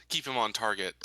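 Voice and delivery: ordinary delivery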